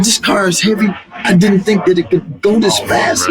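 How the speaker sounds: telling Prince about the car, astonished; stunned amazement. Retold or imitated. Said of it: "I said".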